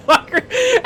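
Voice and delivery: short high burst of laughter. Real, not acted. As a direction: crack up again. Mocking the ending scene.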